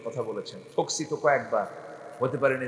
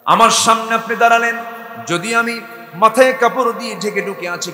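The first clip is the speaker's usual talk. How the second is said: accusing woman; 9 semitones higher, 12 dB louder than usual. Acted. Woman listing his faults.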